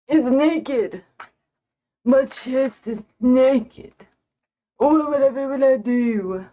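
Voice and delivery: hammed-up melodrama over a bare neck; theatrical mock despair, slow at the middle; mock dramatic. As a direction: play a fainting damsel, tongue in cheek. Mock drama over missing necklace.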